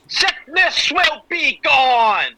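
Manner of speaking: high, loud, drawn-out holler; mock healing cry. Cheering on a sick cohost, mock triumphant shout. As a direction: belt it out as a playful proclamation.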